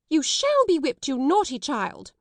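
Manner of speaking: high, loud furious threat; thundering. A narrator's reading. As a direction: headmistress raging at the child. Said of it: "voice rose until it almost thundered".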